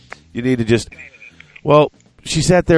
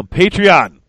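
announcer call; 8 semitones higher, 5 dB louder than usual. Acted. Announcing Patreon segment.

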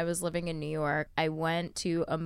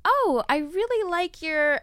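mock admirer; 11 semitones higher, 4 dB louder, slower than usual.

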